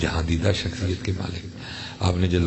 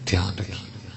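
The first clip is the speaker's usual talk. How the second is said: whispered warning; 4 dB quieter than usual. Acted. Warning repeated to congregation.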